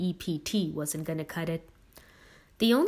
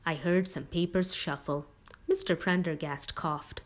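usual speaking voice

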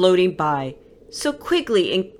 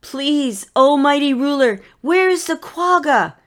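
a touch of fear, higher and louder; quaking with fear; 9 semitones higher, 4 dB louder than usual. Acted. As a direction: plead nervously with the mighty ruler. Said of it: "quaking with fear".

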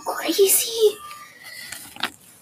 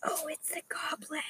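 frightened murmur; 17 dB quieter than usual. Acted. Boy frightened by creepy voice.

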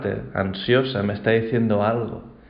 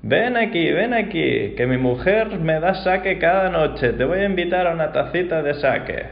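put-on an old man invites the drunk over kindly; cheerful old man; 7 semitones higher than usual.

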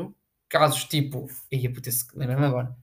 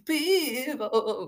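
mock crying; 8 semitones higher, 6 dB quieter than usual. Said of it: "A chorar, bué".

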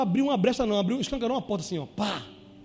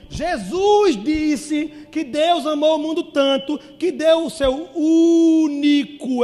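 slow, loud, high reading dwelling on único; stretched-out loud reading stressing único; slow emphatic reading; 4 semitones higher, 6 dB louder, much slower than usual. Shown as a demonstration. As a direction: draw out every word, landing on único. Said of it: "vamos ler de novo aqui".

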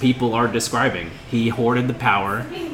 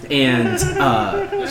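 ordinary talk, nothing marked

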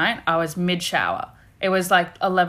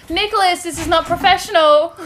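mock scolding; 9 semitones higher, 5 dB louder than usual.

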